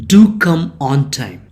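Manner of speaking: "Do come" hit loud, "on time" falling away; emphatic DO. Shown as a demonstration. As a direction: press hard on "Do" to urge it. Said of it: "you are stressing that come".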